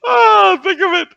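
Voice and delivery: very high, loud gasp into high-pitched urging; gleeful sadistic madman. Acted. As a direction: tempt them like a delighted maniac. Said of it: "you're doing this sadistic thing".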